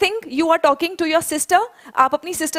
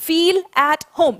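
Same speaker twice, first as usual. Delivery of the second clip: slow repeat-after-me; much slower than usual. Shown as a demonstration. Teacher leading her class in slow choral repetition. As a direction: feel at home drawn out slowly word by word. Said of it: "मेरे साथ-साथ pronounce करेंगे".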